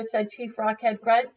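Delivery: ordinary delivery, nothing marked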